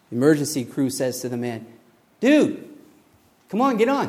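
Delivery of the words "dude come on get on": higher, louder jet ski rescuer urging; casual call out. Acted. Jet ski crew urges man on roof, high and loud. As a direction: hurry him aboard, easy and loud.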